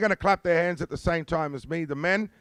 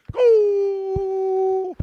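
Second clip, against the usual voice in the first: shout; 7 dB louder than usual. Real, not acted. Dance leader calling out.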